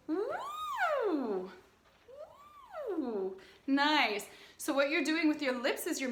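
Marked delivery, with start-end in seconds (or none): 0.1-1.5 high open woo sound; high breathy-free woo. Shown as a demonstration. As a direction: slide up on woo. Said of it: "Just go".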